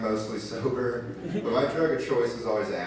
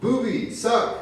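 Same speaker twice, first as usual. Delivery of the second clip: put-on high, slow baby voice; imitating his little daughter; toddler voice; 13 semitones higher, 3 dB louder, slower than usual. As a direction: coo like a greedy toddler. Toddler voice in bit.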